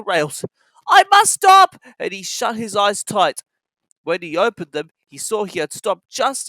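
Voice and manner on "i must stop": high, loud straining cry; desperate cry. A narrator's reading. Thomas shutting his eyes, crying out. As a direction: strain to stop.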